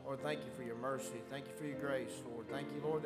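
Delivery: ordinary delivery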